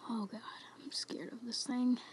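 scared breathy whisper; trembling whisper. Real, not acted. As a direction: whisper it, frightened and shaking. Scared while hiding.

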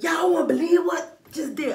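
normal talking voice

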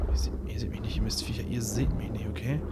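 hushed whisper, barely voiced; sly whispered taunt at the enemies. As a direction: whisper a taunt while sneaking past. Sneaking past enemies in game.

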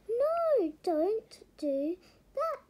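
high loud cry of alarm; shouting. Acted. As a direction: shout it out to stop him. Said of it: "said Sarah".

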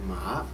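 "Ma" starting low, gliding upward; rising second tone. Shown as a demonstration. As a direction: model "Ma" with a clear rise for repeating. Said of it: "The second tone is rising".

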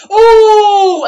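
high, loud sympathetic ooh; a crowd gasping together; crowd wince. Retold or imitated. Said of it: "the crowd starts going".

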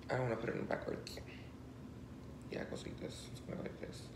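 soft unvoiced whisper to herself. Real, not acted. Whispering to herself while putting on the necklace. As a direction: whisper while fastening the piece.

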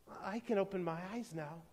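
soft, dawning discovery; hushed, gentle wonder; quiet proclamation. A narrator's reading. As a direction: softly realize it aloud. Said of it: "she proclaimed".

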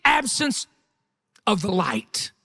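loud, high, driving pulpit cry; full-force preaching. Real, not acted. Preacher telling congregation how Peter hid the light, full-force preaching. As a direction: preach it out at full volume, stretching the climactic words.

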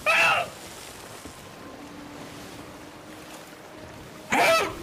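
high shrieking cry for help; panicked scream. Acted. Sinking ship, swallowed.

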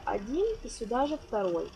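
normal talking voice

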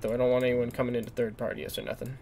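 usual speaking voice

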